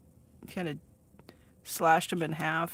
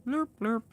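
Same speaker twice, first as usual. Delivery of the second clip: blurp noises; 6 semitones higher, 4 dB louder than usual. Pretend. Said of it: "my camera just decides to go".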